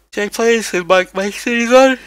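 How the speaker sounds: talking through a yawn; stretched, yawning words. Real, not acted. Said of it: "Yes, I am tired".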